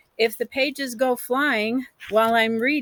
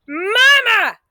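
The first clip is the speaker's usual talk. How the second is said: high, loud cry of Mama; exploding shout; 12 semitones higher, 7 dB louder than usual. A narrator's reading. Child Orme bursting out at Mama in the cellar, shouting. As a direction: burst out calling for her. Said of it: "I exploded".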